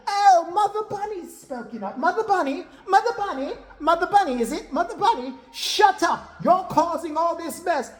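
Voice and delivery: high sing-song mock taunt turning to scolding; imitated taunting. Kim jeering back at Regina, high imitated taunt. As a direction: jeer in a hammed-up high voice.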